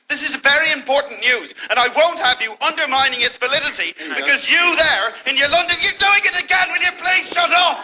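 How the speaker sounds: scolding that climbs to high fury; indignant rant. Acted. Correspondent berating distracted presenter, rising anger. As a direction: build from stern rebuke to exasperated yell.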